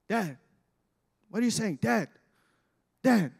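lightly voiced children calling Dad; short, puzzled calls across the house. Children straining to hear their whispering father, lightly imitated calling.

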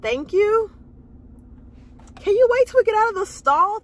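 high, loud questioning thanks; incredulous thanks. Retold or imitated. To a chatty lady outside the stall, high incredulous thanks. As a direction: question her timing.

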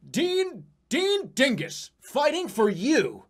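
slow, high ad-slogan voice; slow, hammed-up political ad read; mock campaign slogan. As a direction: read the billboard like an ad.

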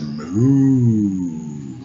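low, drawn-out cow moo. Acted. The cow answers Farmer at the picnic, a low moo.

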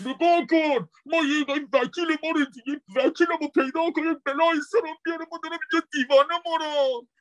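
high, fast cartoon panda man in a Mashhadi accent. Panda husband begs his wife for a lawyer, Mashhadi accent. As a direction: plead in a broad Mashhadi accent. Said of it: "صدای مرده رو با لهجهٔ مشهدی".

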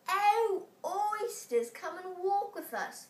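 high, coaxing plea to the oysters; beseeching walrus. A narrator's reading. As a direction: lure them in sweetly. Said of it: "the Walrus did beseech".

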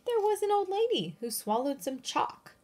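usual speaking voice

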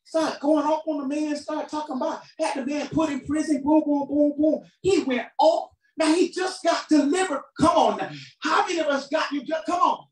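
high, urgent preaching in driving repeats; full-force preaching. Real, not acted. Preacher confronting the forgiven who won't forgive, full-force preaching. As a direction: build it in insistent, high-pitched waves.

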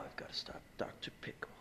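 whisper. Acted. Sneaking alone after escape.

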